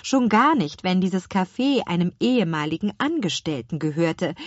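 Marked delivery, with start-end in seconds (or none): none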